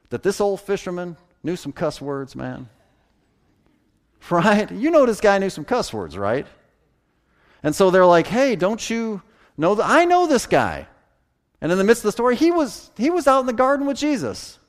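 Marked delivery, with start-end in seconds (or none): 4.3-14.6 much higher, louder preacher's fervor; fervent preaching. Real, not acted. Preacher urging congregation to tell the truth, fervent. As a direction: lift the congregation with rising passion.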